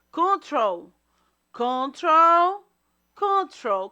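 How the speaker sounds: "control" loud and high, leaning hard on "trol"; stress on second syllable. Shown as a demonstration. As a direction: punch out "control", drawing out the stressed final syllable. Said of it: "Qual é a sílaba mais forte?". ("control" bem forte, com ênfase em "trol")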